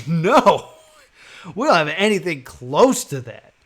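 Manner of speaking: a hammed-up clerk shutting him down; mock salesman refusal. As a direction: bark out the store clerk's no. Salesman quoted flatly refusing.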